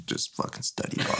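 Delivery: hushed urgent whisper. Retold or imitated. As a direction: hiss it so mom can't hear. Must stay quiet, stoned.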